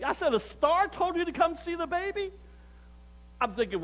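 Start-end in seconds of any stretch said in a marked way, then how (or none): 0.3-2.3 high-pitched disbelieving question; acted incredulity, climbing high; incredulous echo. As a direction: throw it back in disbelief, pitched way up. Narrator repeats answer in disbelief.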